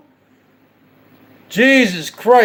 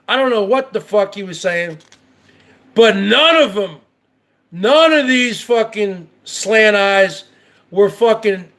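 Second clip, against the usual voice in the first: normal talking voice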